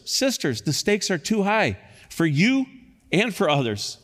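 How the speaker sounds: high, pressing sermon exhortation; preaching at full force; impassioned preaching. Acted. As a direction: urge the church to make peace.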